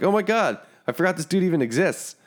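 surprised. Retold or imitated. Retold shock at seeing player.

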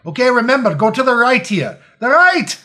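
high loud bossy instructions; fight choreographer. Pretend. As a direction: direct the actors like a stressed coach.

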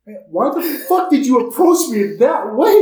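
loud, high, fed-up outburst; exasperated shout. Retold or imitated. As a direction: yell it, sick of being ambushed. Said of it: "And I'd be like".

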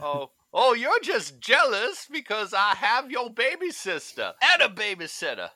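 shrill cartoon taunt, high and loud; high mocking cartoon voice. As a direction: squeal it like a cartoon brat. Acting cartoon line, high far voice.

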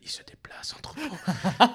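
slow, whispered movie line; drawn-out mock-ominous whisper; imitated eerie whisper. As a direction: whisper it slowly, imitating the actor. Quotes the actor's one spoken line.

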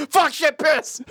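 high, loud ad-tag yell; commercial shout. Acted. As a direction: blurt it like a hammy ad tagline. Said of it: "new commercial".

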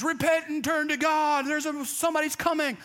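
mock street preacher; street preacher call. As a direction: proclaim it like a street corner prophet. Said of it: "trying to proclaim John's message".

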